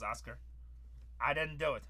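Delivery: usual speaking voice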